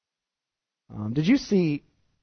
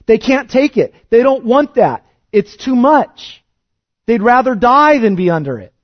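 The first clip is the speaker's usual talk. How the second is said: higher, louder, pressing preacher; impassioned preaching; 7 semitones higher, 7 dB louder than usual. Real, not acted. Preacher telling the church of oppressed peoples, loud and impassioned. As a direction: drive it home with rising passion.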